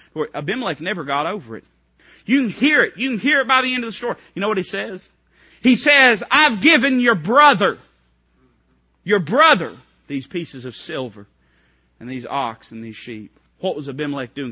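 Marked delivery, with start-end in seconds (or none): 2.3-9.8 higher, louder preacher's cry; full-force preaching. Acted. Preacher pressing the congregation, full-force preaching. 10.1-13.3 a mild snarl of contempt toward the brother; grudging, half-sneered payment; snarling with disdain. Retold or imitated. King paying off Abraham, mild snarl of disdain. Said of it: "Can't you hear the snarl in his voice?".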